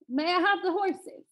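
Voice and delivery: lightly voiced prince asking up high; prince's request. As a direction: ask eagerly for a gift. Prince asks giant for horses.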